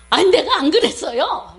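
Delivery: mock-indignant denial; retold angry denial. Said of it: "이랬어요".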